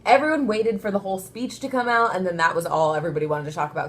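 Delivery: ordinary talk, nothing marked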